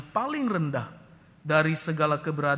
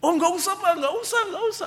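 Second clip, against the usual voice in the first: put-on child pleading, near tears; 8 dB louder, faster than usual. Son pleads tearfully.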